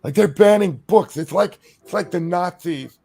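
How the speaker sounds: high, loud mock alarm; mock outrage. Mocking alarmed critics' outrage.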